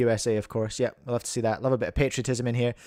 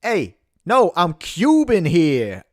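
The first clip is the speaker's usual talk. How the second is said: put-on New York accent; 5 semitones higher, 7 dB louder, slower than usual. American [name] answering the host, New York accent. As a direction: ham up a Long Island tough guy. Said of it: "it's your ridiculous accent".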